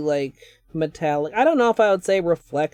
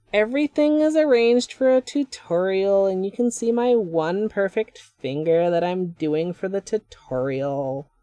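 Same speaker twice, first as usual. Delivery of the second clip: put-on tutorial voice; 3 semitones higher than usual. Nail vlogger mocking over-arranged tutorials in a put-on presenter voice. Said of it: "decided to use that voice".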